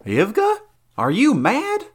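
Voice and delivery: high, loud shocked question; startled knight. Acted. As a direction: rebuke her for coming. Valerius startled by Ivga.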